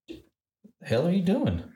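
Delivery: high, drawn-out disbelief; incredulous retort. Retold or imitated. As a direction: stretch it out high, mock-appalled. Retold reaction to cameraman.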